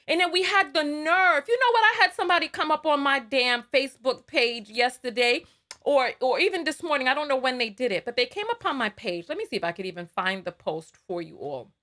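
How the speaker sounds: normal talking voice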